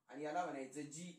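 normal talking voice

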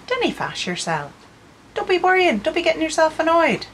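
soothing, rising high on "Don't be worrying"; calm someone down in a sing-song Ulster voice; reassuring Ulster Scots phrase. Shown as a demonstration. Artist showing viewers how to soothe someone with "dinna fash", high and sing-song. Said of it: "I might say to you".

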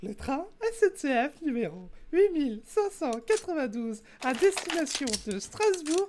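station announcer voice; sing-song SNCF platform announcement; over the intercom. Pretend. Mimics SNCF train announcement.

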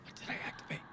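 hushed whisper. Real, not acted. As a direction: whisper it to yourself. Quiet confused whisper.

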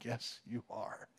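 low, very soft reply; retold smitten aside. Recalling meeting his wife, low smitten aside. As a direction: answer under your breath, low and smitten.

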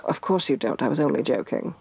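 usual speaking voice

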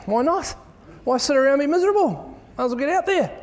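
high, lifted call to get out there; rally them, voice up high; high-pitched upbeat push to stop moping; excited rally. Real, not acted.

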